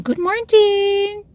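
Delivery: very high, slow drawn-out good morning; cheery high-pitched hello; sing-song greeting. Real, not acted. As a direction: sing it out high to a passing coworker. Greeting passing coworker.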